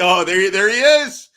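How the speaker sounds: loud, high burst of recognition; excited recognition. Real, not acted. Host spots his producer mid-story, excited recognition. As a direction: pitch up, call out as you spot them.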